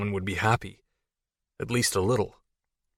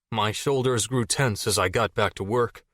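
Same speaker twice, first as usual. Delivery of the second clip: ordinary delivery, nothing marked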